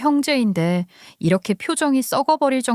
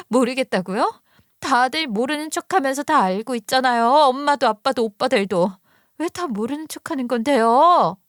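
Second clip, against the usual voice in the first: higher-pitched indignant sister-in-law; acted daughter at the end of her patience; confronting daughter; 4 semitones higher than usual. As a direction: accuse the whole family, voice raised in pitch. Narrator voices angry sister-in-law.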